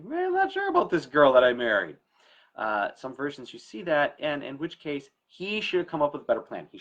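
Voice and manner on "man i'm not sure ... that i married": doubtful father. Retold or imitated. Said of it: "he's just kinda like".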